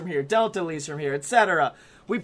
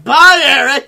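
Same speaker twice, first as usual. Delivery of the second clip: loud, higher sarcastic goodbye; mock farewell shout; 5 semitones higher, 13 dB louder than usual. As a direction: fling the goodbye at him on the way out. Leaving the car, calling out.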